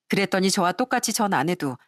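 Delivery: ordinary delivery, nothing marked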